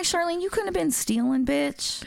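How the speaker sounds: high-pitched mock scolding; playful high-voiced protest; retold reproach. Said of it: "I'm like".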